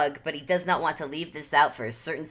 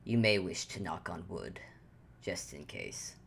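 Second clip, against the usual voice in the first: low, quiet, dry aside; dry warning; 9 semitones lower, 10 dB quieter than usual. Acted. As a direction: warn her deadpan, softly. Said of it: "look at Ruby and go".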